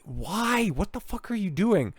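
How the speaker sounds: high-pitched incredulous whine on "doing"; exasperated. Real, not acted. Exasperated at the spender.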